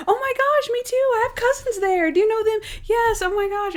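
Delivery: very high, squealing retold chatter; mock gushing excitement. Caller and producer share Wisconsin cousins, mock thrilled, high.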